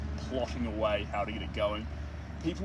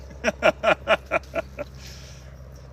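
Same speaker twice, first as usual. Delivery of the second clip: high, bursting laugh; 7 semitones higher, 4 dB louder than usual. Real, not acted. Streamer cracking up after their Aussie impression, high laugh. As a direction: laugh out at your own joke.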